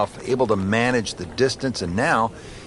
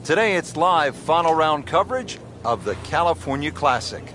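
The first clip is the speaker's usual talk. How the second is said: proud, higher broadcast intro voice; TV announcer; 4 semitones higher than usual. Acted. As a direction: open the show like a network announcer.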